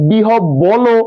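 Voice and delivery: "বিহব বলো" slowly, with an extra o on the ল; wrong vowel ending. Shown as a demonstration. Teacher questioning the class, wrong final o in "বিহব বলো". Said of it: "আমরা কি কখনো বলছি".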